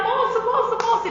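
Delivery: loud, high calls for the man; calling out. Retold or imitated. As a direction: shout after him to stop. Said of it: "E eu comecei a gritar". (gritando alto pelo moço)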